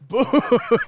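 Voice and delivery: sudden loud, high "Boo!"; startling boo. Retold or imitated. Startling a rude stranger with a loud high boo. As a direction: jump out at him with a loud yelp.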